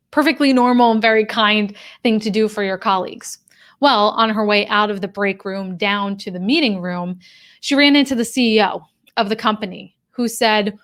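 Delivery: ordinary delivery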